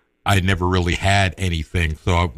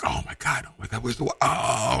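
panicked breathless whisper, much softer; retold panic; 5 semitones higher, 6 dB quieter than usual. Boy realizing at home he lost the watch, panicked whisper. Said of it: "I'm freaking out".

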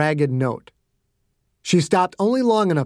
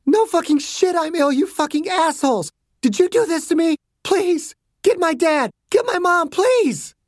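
high, strained, furious outburst turning to begging; distraught patient, pitched way up; frantic captive girl; 14 semitones higher than usual. Acted. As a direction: rage at your captors, then plead. Sick captive girl rages and pleads.

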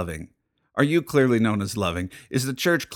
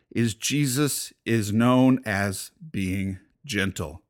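usual speaking voice

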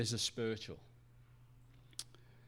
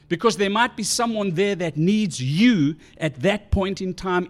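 louder, full-force pulpit passion; passionate preaching; 4 semitones higher, 6 dB louder than usual. Real, not acted. Pastor urging the congregation to keep gathering, loud passionate preaching. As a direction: preach it loud and driving, pressing every point. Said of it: "I'm feeling passionate".

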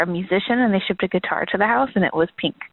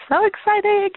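high-pitched burst of delight; excited; 13 semitones higher than usual. Real, not acted. Caller congratulating hosts on the baby, excited. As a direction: squeal it happily, much higher than usual.

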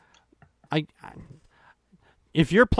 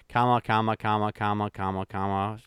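softer and lower each time, droning; fading mockery; 9 semitones lower, 7 dB quieter than usual. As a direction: let it fade away, droning. Mocking the punctuation.

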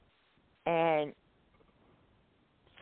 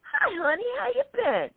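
a much higher, sing-song hello; high mock greeting; 13 semitones higher than usual. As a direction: put on a sugary high voice to greet them. Retold greeting in a put-on voice.

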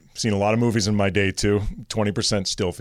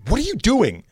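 put-on high, sharp old lady's demand; startled old lady; 10 semitones higher than usual. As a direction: snap at the stranger like an offended old lady. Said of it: "I hear this".

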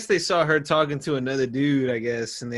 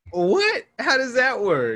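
sudden high disbelieving what; baffled, asking in disbelief; surprised; 9 semitones higher, slower than usual. Real, not acted. Hearing the shocking divorce rumor.

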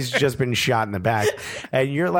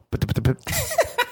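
rapid high-pitched sputter; mimicked spray noise. Storyteller mimicking mayo hitting his arms, spray sputter. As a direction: imitate spattering drops in quick little pops.

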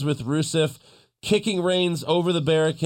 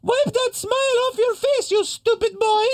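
very high, shrill-voiced mock yell; hammed-up Lana impression. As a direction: screech it like an angry wrestling manager. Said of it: "Lana yells".

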